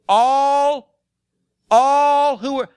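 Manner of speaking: "all" held long on a high pitch; stress on "all". Real, not acted. Preacher reading healing scripture to congregation, "all" emphatically stretched and high. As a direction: hit "all" high and hard, drawing it out.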